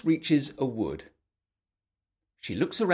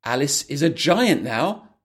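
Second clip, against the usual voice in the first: ordinary delivery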